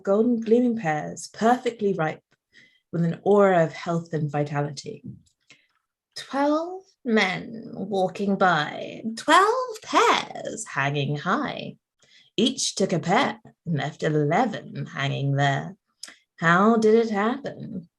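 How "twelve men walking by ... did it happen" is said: thin reedy voice. Acted. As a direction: draw each line out like an eerie chant. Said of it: "thin, reedy voice".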